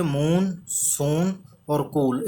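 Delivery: slow. Shown as a demonstration. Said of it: "ये देख लीजिए".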